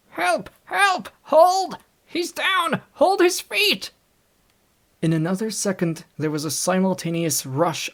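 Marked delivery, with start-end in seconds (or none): 0.1-3.9 very high, loud cries for help; desperate screaming. A narrator's reading. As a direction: scream for help while grappling. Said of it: "screamed Kemp".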